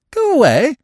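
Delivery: high, questioning rise; puzzled echo. Acted. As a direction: echo it in disbelief. Freddy echoes puzzled.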